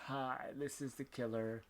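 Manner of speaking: hushed killer impression; soft, put-on phone menace. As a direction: imitate a creepy caller, very softly. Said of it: "it's more like".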